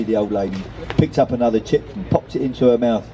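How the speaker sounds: usual speaking voice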